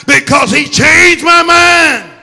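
loud, driving sermon voice; full-force preaching. Acted. Preacher rallying the congregation, preaching full force. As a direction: preach it hard over the music.

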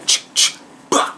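loud spat gunfire sound effect; mock gunshot noise. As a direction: blast the flag with mouth-made shots. Miming gunfire at surrender flag.

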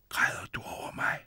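breathy whisper. A narrator's reading. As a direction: whisper it close to her. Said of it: "hviskede han".

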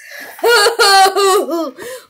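high, loud burst of laughter. Acted. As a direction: crack up at your own joke. Laughs at own joke.

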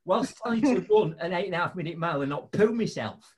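usual speaking voice

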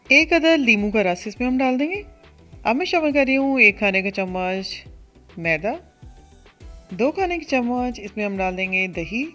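ordinary talk

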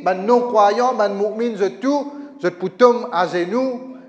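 ordinary delivery

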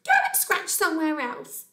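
high, loud, tiny-creature scolding; indignant millipede. Acted. Millipede defends its house roof from Bear, high indignation. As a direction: scold as a cross little creature. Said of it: "demanded a teeny tiny millipede".

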